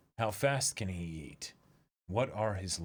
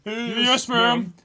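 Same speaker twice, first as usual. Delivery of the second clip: high, loud yes-ma'am; eager minion reply; 12 semitones higher, 9 dB louder than usual. Acted. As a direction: answer like obedient soldiers. Dubbed soldiers answering.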